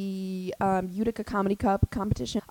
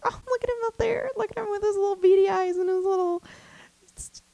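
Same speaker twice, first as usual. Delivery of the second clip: high, cooing proud mom voice; hammed-up proud mom coo; stage mom; 9 semitones higher than usual. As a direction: gush like a doting stage mom. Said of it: "like a little stage mom".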